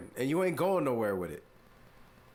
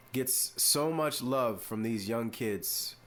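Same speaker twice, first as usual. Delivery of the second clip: ordinary talk, nothing marked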